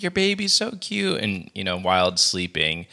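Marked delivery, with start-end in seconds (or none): 0.0-1.2 higher, gushing server voice; mock manic server cooing at a baby. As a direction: gush like an overeager waitress. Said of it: "this manic server comes up".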